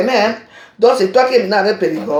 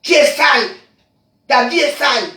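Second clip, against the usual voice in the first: higher, loud insulting yell; yelling the insult at him; furious shout; 5 semitones higher, 4 dB louder than usual. Real, not acted. Insulting rival in rant.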